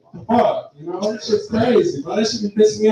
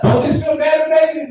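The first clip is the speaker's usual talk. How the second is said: grandma asking; 8 semitones higher than usual. Retold or imitated. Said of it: "it was like".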